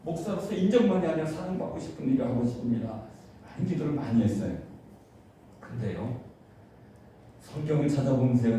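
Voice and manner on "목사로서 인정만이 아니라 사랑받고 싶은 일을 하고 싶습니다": high, loud pleading prayer that sinks to a hush; impassioned prayer. Acted. Pastor reenacting his own prayer to God, pleading and fervent. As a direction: cry out to God, then drop to a near whisper.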